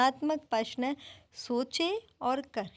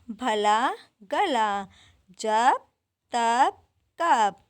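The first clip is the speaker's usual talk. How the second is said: slow first rhymes, then louder and punchier; rhyming words read aloud; 4 dB louder, much slower than usual. Shown as a demonstration. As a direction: recite the rhyme list back to the class. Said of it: "मैंने आपको लिखकर दिखाए". (तुक वाले शब्द पहले धीरे फिर ज़ोर से दोहराना)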